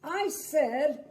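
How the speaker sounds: angry outburst. Acted. Grumpy begins to snap.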